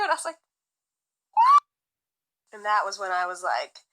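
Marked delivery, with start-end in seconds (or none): 1.3-1.6 very high, loud, incredulous "What?"; shocked what. Retold or imitated. Her ex says he wants to see her, incredulous. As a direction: squeak out disbelief.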